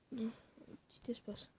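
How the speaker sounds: breathy whisper, slow. Real, not acted. Dictating to the screen in a slow whisper. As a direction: whisper it slowly as if typing.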